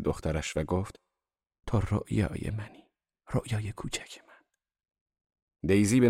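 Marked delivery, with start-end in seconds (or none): 1.7-4.4 put-on dote softly on a small child; hushed, lingering endearment; tender. A narrator's reading. Mother doting on her little daughter.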